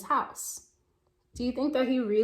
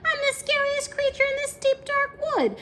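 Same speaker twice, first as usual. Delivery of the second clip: much higher squeaky mouse boast; boastful mouse; 13 semitones higher than usual. Acted. Mouse boasting to the Gruffalo, high and proud. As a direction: puff up a tiny voice. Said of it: "said the mouse".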